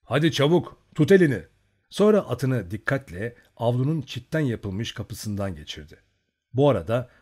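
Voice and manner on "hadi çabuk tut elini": louder, higher hurried order; urgent order. A narrator's reading. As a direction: hurry your friend along. Urging his friend. (arkadaşını aceleyle, yüksek sesle sıkıştırarak)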